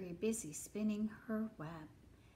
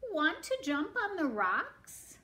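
higher, bouncing invitation; storybook goat; 7 semitones higher, 4 dB louder than usual. Acted. Goat invites the spider to jump, higher playful voice. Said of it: "said the goat".

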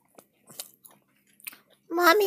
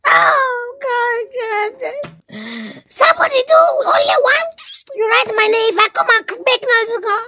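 whiny lament; 8 semitones higher than usual. Acted. Boy laments being listed.

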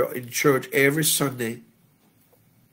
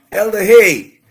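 loud, high shouted greeting; calling out; 8 semitones higher, 14 dB louder than usual. Real, not acted. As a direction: hail someone across the congregation.

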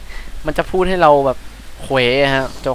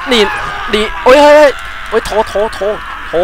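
sudden high startled cries; real fright, high and loud; startled yelps; 7 semitones higher, 4 dB louder than usual. Real, not acted. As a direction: jump at the scare and yelp. Startled by enemy jumping out.

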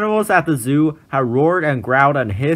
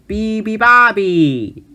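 high, loud trumpet blast; 8 semitones higher, 5 dB louder, slower than usual. A narrator's reading. Elephant trumpeting the bird's song, loud. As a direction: blare it like an elephant. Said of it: "trumpeted the elephant".

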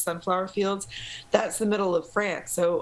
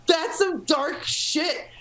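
laughing; 11 semitones higher, much slower than usual. Real, not acted. Laughing at own bleak story.

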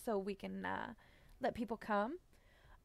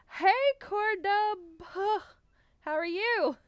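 high, loud, drawn-out hello; high-pitched, gushing welcome; excited greeting; 10 semitones higher, 6 dB louder, slower than usual. Real, not acted.

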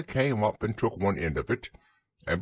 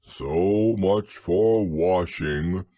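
slow, heavy donkey voice; Eeyore; 3 semitones lower, 4 dB louder, much slower than usual. A narrator's reading. Eeyore remarks after Roo falls in, slow and grumbling. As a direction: draw it out very slowly. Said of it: "said Eeyore".

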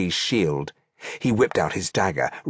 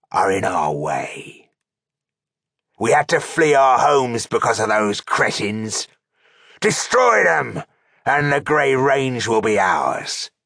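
rallying orc chieftain; 2 semitones higher than usual. A narrator's reading. Orc chieftain points his sword at the enemy, rallying. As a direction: rouse the horde, voice swelling on the commands. Chieftain rallies army before battle.

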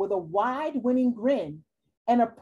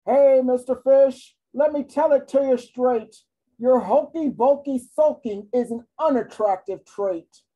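louder, straight-talking octopus; blunt octopus; 5 dB louder than usual. Acted. Octopus scolding the sulky fish, loud and blunt. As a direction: tell it to him straight.

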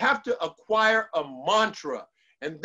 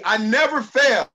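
loud, firm declaration of the words; loud affirmation; 8 dB louder than usual. Shown as a demonstration. Coach repeating the affirmation with the audience, loud. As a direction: proclaim it as a rallying cry. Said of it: "Say it with me".

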